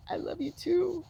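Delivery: high, choked-up affection; tearful. Retold or imitated. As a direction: say it back through retold tears. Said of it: "I'm crying".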